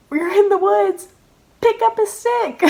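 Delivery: high, incredulous, near laughing; exasperated self-reproach. Real, not acted. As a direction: spell out the obvious in a high voice. Scolding herself for stupidity.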